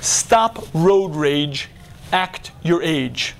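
slow, drawn-out reading; reading the sign. Retold or imitated. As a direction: read each word out slowly. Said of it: "sign on the road that says".

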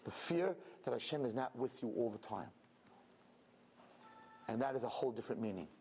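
ordinary talk, nothing marked

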